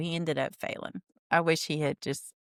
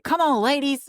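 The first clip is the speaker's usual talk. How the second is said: mock rallying call; 10 semitones higher, 6 dB louder than usual. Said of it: "He's like".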